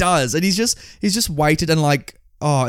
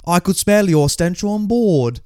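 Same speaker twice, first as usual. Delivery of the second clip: slow, higher mock British princess; British accent; 5 semitones higher, slower than usual. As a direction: put on an exaggerated British accent, drawn out. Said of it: "like, this British accent".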